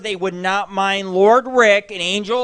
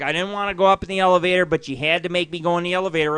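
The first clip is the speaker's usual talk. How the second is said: fast complaining; much faster than usual. Retold or imitated. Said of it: "She's like".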